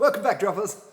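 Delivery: giggling through the greeting; laughing. Real, not acted. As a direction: laugh while saying it. Laughing intro.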